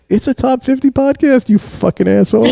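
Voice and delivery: high, loud indignant comeback with laughter in it; laughing, wounded-pride protest; mock outrage. Dad defends his podcast to his son, mock outrage.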